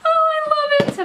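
very high, delighted squeal; thrilled squeal. Real, not acted. Vlogger thrilled by a decorated gift, squealing delight.

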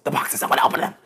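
eager stage whisper, all breath; little kid whispering. Acted. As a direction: whisper it like an impatient child. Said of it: "I feel like a little kid".

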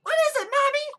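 put-on very high, loud kid voice; small child. Child asking mother about a monster, high-pitched. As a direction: pipe up like a little child.

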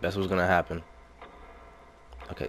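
ordinary talk

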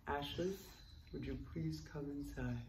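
low, soft gentle plea; old man. Retold or imitated. Old interventionist calls Ashley inside, low hushed plea. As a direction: murmur it deep and hushed.

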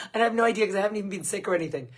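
rushed, laughing through the words. Real, not acted. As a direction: giggle while you talk fast. Laughing about losing voice.

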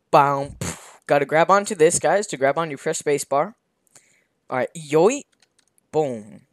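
normal talking voice

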